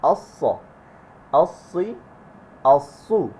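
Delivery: thick "অস্স" from a curved tongue, lips unrounded; loud, full doubled S in "অস্সি, অস্সু"; heavy s. Shown as a demonstration. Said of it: "জিহ্বাটাকে একটু curve করবো". (মুখ গোল না করে মোটা "অস্স")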